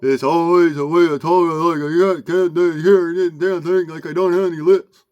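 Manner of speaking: loud, mouthed words without lips; loud lipless dog mumble; dog-style speech. Shown as a demonstration. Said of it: "one is spoken like a dog".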